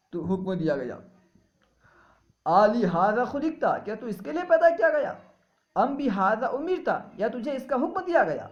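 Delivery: high, loud calling voice from nowhere; unseen caller. Retold or imitated. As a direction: call out like a mysterious voice from the unseen. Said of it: "ایک آواز دینے والے نے مجھے آواز دی". (بلند اور اونچی غیبی پکار)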